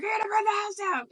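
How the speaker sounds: high-pitched, quick mock scolding; mock yell. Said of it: "you yelled at me".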